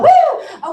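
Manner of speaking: a high, loud woo of pure glee; excited whoop. Acted. Trainer cheering after the excited booking demo, high loud whoop. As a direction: whoop like you just won.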